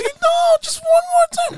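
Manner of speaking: high, loud mock begging; insistent partner impression.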